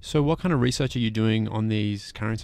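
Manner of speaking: normal talking voice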